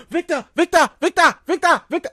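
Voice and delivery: high, whiny mimic calling Victor over and over; imitating Tabitha pestering Victor, mock whiny. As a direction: nag like the annoying character.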